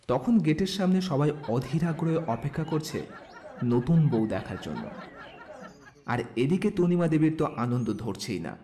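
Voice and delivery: usual speaking voice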